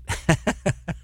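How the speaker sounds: quick unvoiced laugh; a brief breathy chuckle; light laugh to himself; short laugh. Real, not acted. Laughs after story.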